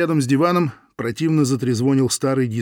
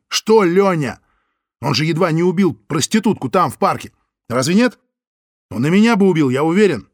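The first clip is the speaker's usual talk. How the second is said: loud heated rant; angry rant; 5 semitones higher, 5 dB louder than usual. Acted. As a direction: rant hotly at her. Лёня rants at Соня.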